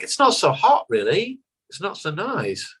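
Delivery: high-pitched imitation of English fans; unimpressed English fans. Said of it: "And they're like".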